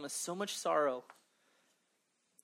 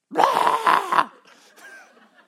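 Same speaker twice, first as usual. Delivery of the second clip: loud burst of laughter; real loud laugh at his own joke; big laugh; 18 dB louder than usual. Real, not acted.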